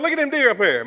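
high, loud excited call; excited pointing out. Retold or imitated. Dad showing his kids the deer, high and loud with excitement.

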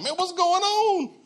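a baffled king who can't see why plagues keep coming; bewildered Pharaoh. Retold or imitated. Pharaoh crying out at the plagues, high and bewildered.

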